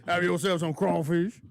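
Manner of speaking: put-on Cajun Southern accent, big and playful. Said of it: "Deborah wants you to do a Southern accent".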